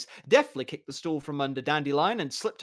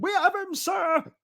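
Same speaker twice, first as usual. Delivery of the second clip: much higher, loud shout; triumphant yell; 4 dB louder than usual. Acted. Mason shouting to official.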